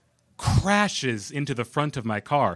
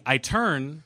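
ordinary talk

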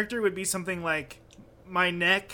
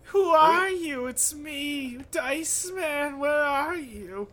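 high, slow nasal whine; nasally wife voice; 8 semitones higher, much slower than usual. Pretend. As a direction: whine out the words. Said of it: "like, the nasally voice".

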